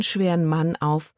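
plain reading voice

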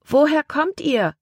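put-on high little-girl voice, calling. A narrator's reading. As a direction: call up to the boat like a curious girl. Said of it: "rief ein Mädchen". (hohe Mädchenstimme, rufend)

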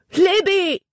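high call from far off; calling out her name; distant shout. A narrator's reading. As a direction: yell her name across the water. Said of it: "den Schrei".

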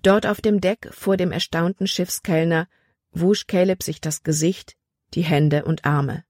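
ordinary delivery

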